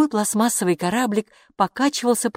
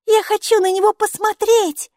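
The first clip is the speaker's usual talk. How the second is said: put-on very high, louder child voice; eager little girl; 5 dB louder than usual. A narrator's reading. Maiki wants to see the elf, eager high child voice. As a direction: pipe up like a small child demanding a look. Delighted small girl begs to look.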